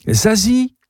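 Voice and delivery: high, sweet call; gentle singsong summons; gently calling out. A narrator's reading. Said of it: "crie-t-elle doucement".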